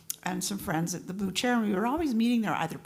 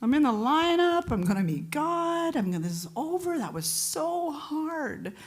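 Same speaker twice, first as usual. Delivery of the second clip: relieved Eddie; 8 semitones higher than usual. Retold or imitated. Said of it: "And he's like".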